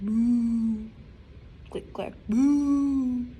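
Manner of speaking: drawn-out mooing refrain; slow sound refrain. Acted. Librarian reads the cows' typing refrain slowly. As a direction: stretch it like a slow chant.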